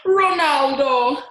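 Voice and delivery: slow, loud bellowed name; bellowing. A narrator's reading. Teacher bellowing a boy's name, slow and loud. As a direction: bellow his name across the room. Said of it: "bellowed Missus Walush".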